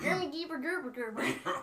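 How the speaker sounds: mock gibberish. Shown as a demonstration. Demonstrating gibberish for the other speaker, mock foreign babble. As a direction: rattle off silly nonsense syllables. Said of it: "I do gibberish".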